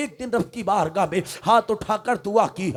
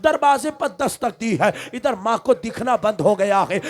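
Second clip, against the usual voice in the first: usual speaking voice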